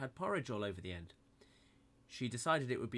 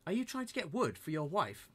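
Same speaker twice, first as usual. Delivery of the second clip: put-on higher, louder little-girl voice; higher girlish voice; girl's question; 7 semitones higher, 5 dB louder than usual. A narrator's reading. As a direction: ask brightly in the girl's voice. Moldy Pubes asks woodsman.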